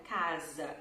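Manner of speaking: Casa said with a z in the middle, /kazɐ/; Casa, the s sounding like z. Shown as a demonstration. Teacher modelling casa slowly for learners. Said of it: "a palavra casa".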